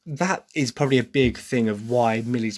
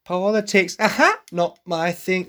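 imitating Millie; 7 semitones higher, slower than usual. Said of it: "when Millie's like".

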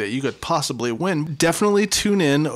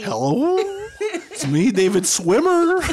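high, laughing goldfish voice, slow on the name; goldfish come to life; 12 semitones higher, slower than usual. Pretend. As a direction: squeak it out like a cartoon fish, giggling. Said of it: "I'm a goldfish that came to life".

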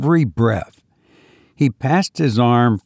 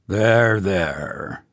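fond; 3 semitones lower than usual. A narrator's reading. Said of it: "said Benson fondly".

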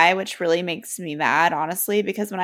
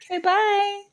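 much higher fake polite bye; mock cheery bye; 12 semitones higher than usual. Sister joining the brush-off of the officer, much higher fake cheer. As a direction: chirp it like a dismissive goodbye. Plays along with sister's story.

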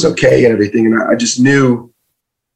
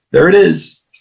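sudden realization, a bit higher; realizing it; 5 semitones higher than usual. Retold or imitated. To himself on hearing his dad is dying, dawning realization. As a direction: land it like you finally get it. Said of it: "I was like".

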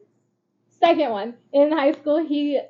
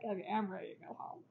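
muttered wish to leave; 5 semitones lower, 17 dB quieter than usual. Retold or imitated. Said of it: "And I'm just like".

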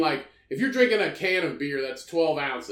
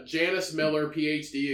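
ordinary talk, nothing marked

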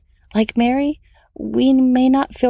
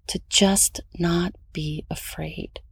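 slow hushed whisper; 3 semitones lower, 6 dB quieter, slower than usual. Real, not acted. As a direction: whisper it slowly, tenderly. Whispered emphasis in devotional.

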